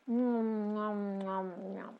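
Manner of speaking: soft distant nom nom chewing; munch quietly like grazing sheep; munching sheep noise. Acted. Sheep eating grass in story.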